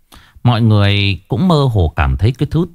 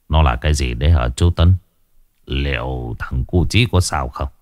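put-on worried old man; 7 semitones lower than usual. A narrator's reading. Worried father asks the monk.

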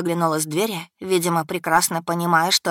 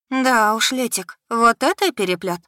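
higher-pitched friend, mildly sighing; sighed remark; 5 semitones higher, 3 dB louder than usual. A narrator's reading. Friend reacting to her mess, sighing in a higher voice. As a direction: let a sympathetic sigh colour the exclamation. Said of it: "вздохнула Аля".

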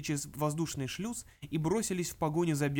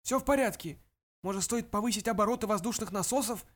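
character voice; 6 semitones higher than usual. Acted. Dubets voiced, character dialogue.

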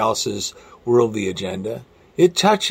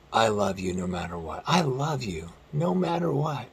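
soft reassurance; 2 semitones lower, 8 dB quieter than usual. Acted. Preacher voicing God's reassurance.

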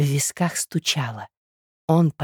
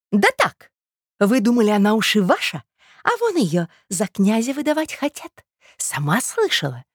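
sharp-tongued tattling woman; 7 semitones higher than usual. Acted. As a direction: break the bad news with relish.

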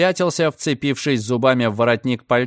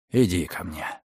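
gentle call; 7 semitones lower, 6 dB quieter than usual. A narrator's reading. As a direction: call softly like to a child. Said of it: "мягко позвал Лонгсдейл". (мягкий зов)

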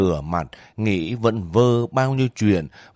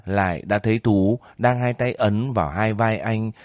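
ordinary delivery, nothing marked

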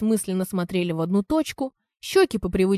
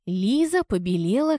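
plain reading voice

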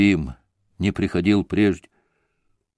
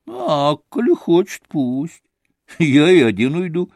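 sarcastic jibe; 6 semitones higher, 4 dB louder than usual. Acted. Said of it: "язвительно подшутил Ракитин".

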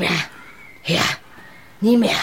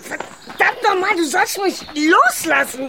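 high, slow yelling to be let go; furious shout; 9 semitones higher, slower than usual. Acted. Woman in a scuffle shouts at her attacker, furious. Physical fight between two women.

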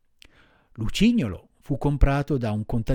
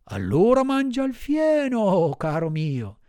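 much higher, slow mocking master voice; gruff master; 7 semitones higher than usual. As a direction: sneer it down at the donkey. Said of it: "replicò il padrone".

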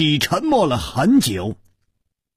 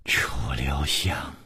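low whisper; 12 semitones lower, 9 dB quieter, slower than usual. Acted. 尹姬 villain reveals name.

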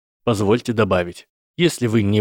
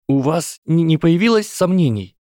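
faltering Frodo; 8 semitones higher, 3 dB louder than usual. Acted. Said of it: "Голос Фродо был слаб ... и дрожал".

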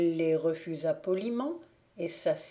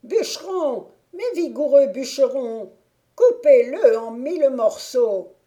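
witch screaming; 7 semitones higher, 12 dB louder than usual. A narrator's reading. Witch to the millers, ordering them to crush the prince: high loud shriek. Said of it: "la sorcière cria".

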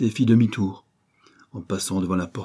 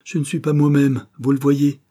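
high, loud flustered apology; agitated exclamation; 7 semitones higher, 6 dB louder than usual. A narrator's reading. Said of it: "s'écria-t-il". (exclamation agitée)

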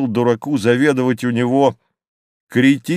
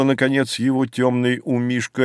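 usual speaking voice

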